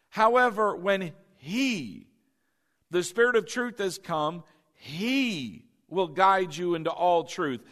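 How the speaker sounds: HE drawn out, set apart by pauses; stress on HE. Shown as a demonstration. Preacher reading the verse closely to the congregation, stress on HE. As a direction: lean long on every "he" in the verse. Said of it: "I emphasize the word he".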